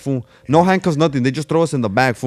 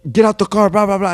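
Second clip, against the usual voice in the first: higher, louder cop command; barking cop; 7 semitones higher, 4 dB louder than usual. Retold or imitated. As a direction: bark the order like a cop. Cop ordering them out.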